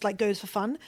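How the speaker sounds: usual speaking voice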